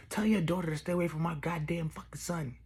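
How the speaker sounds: high, loud imitation of an irate mom; angry mother. Mother warning parents off her son, mock angry. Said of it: "my mother used to always call their parents and be like".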